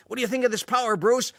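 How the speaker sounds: shouting over the engine, delighted; thrilled showing off. Retold or imitated. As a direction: Steve boasting to Bruce while speeding, thrilled. Steve showing off while driving.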